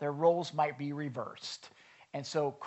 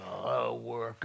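grumbly sigh; 6 semitones lower than usual. Retold or imitated. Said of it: "grumbly attitude".

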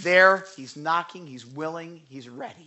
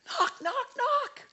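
playful knocking sound; 4 dB quieter, slower than usual. Retold or imitated. Imitating Jesus knocking at door.

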